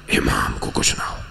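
sudden low, voiceless whisper; hushed devotion. Real, not acted. Preacher voicing companions' wish to protect the Imam, whispered. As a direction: let the voice fall away to a whisper.

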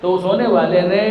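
ordinary talk, nothing marked

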